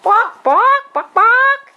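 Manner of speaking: high loud yappy barks; dog bark. Shown as a demonstration. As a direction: yap like a little dog. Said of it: "What does a chicken sound like?".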